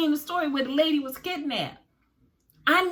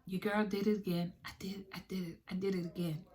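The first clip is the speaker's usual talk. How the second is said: soft embarrassed whisper, trailing off; sheepish whisper; 6 semitones lower, 11 dB quieter, faster than usual. Real, not acted. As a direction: admit it in a guilty little whisper. Embarrassed confession to viewers.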